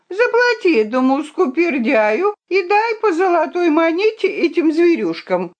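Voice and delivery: high contemptuous order; a princess scorning the knight; haughty command. A narrator's reading. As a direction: look down on the miser. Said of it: "с презрением".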